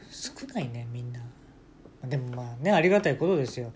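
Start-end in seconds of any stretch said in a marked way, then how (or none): 0.1-1.2 soft unvoiced whisper; whispered aside. Real, not acted. Streamer grumbling about small tips, whispered. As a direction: mutter it under your breath.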